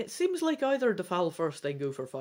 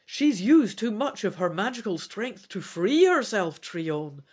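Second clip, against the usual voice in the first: a louder urgent call to Trion; shouting; 2 semitones higher, 8 dB louder than usual. Acted. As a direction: call out over the battle. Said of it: "Rhinath shouts".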